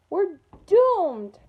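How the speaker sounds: loud, high wail of doom; despairing cry. A narrator's reading. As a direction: howl it like all is lost. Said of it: "cried Arnold".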